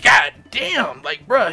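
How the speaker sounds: loud, high god damn, then bruh; exasperated outburst. Retold or imitated. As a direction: call him out in disbelief. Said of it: "I'd be like".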